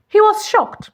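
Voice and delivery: plain reading voice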